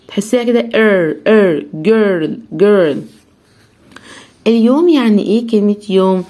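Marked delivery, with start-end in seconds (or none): none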